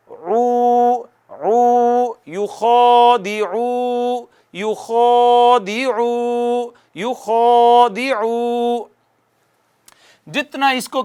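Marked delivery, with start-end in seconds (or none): 0.1-8.8 یخادعو slowly chanted, ع from mid-throat, rounded lips on عو; slow Quran chant; tajweed recitation. Shown as a demonstration. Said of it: "ہونٹ گول کر کے آواز یہاں سے نکالنی ہے".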